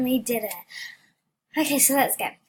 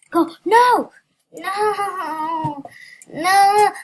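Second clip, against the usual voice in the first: high, loud panicked screams of no; screaming no; 8 semitones higher, 10 dB louder, much slower than usual. Real, not acted. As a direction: yell no as everything falls apart. Game going wrong, screaming.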